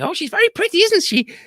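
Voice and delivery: a lovesick parrot asking; high fond parrot. Acted. Parrot asks about sweetheart.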